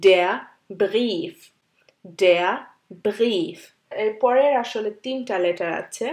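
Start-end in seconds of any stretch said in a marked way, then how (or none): 0.0-3.6 Brief with long ee; German demo word. Shown as a demonstration. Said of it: "I আর ই থাকলে". (Brief উচ্চারণ ব্রীফ)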